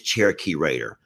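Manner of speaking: ordinary delivery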